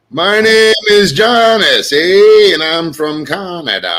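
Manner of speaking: slow, stretched-out, dopey Canadian drawl; dazed Janice impression. Mocking a friend on stream as concussed Janice, slow and dopey. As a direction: talk like you just got hit with a boulder. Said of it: "here's my impression of Janice if she got hit in the head with a boulder".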